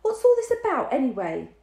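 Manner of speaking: high, loud demand; high, loud suspicious demand. A narrator's reading. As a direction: demand an answer sharply. Dinah demanding.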